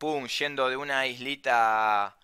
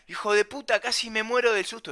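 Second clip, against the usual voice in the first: much higher, agitated retold outburst; agitated scolding; 8 semitones higher than usual. Pranked friend after nearly dying of fright, agitated scolding. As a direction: scold in a raised, high pitch.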